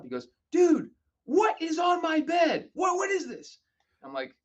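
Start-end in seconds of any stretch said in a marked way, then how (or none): 0.5-3.6 high, loud, slow shout of confusion; baffled yell. Retold or imitated. Keith calling about the soap.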